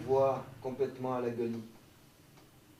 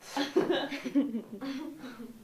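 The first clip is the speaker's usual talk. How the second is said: short laugh; 8 semitones higher, 4 dB quieter than usual. Real, not acted. Student's odd line gets a laugh.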